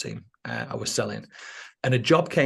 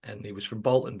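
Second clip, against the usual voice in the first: ordinary delivery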